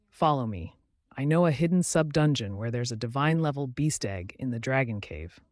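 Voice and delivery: usual speaking voice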